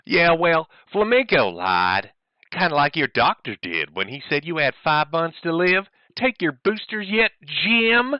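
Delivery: high, hammed-up Southern drawl; mock Southern voice. Streamer reading his rival's message in a mock Southern accent.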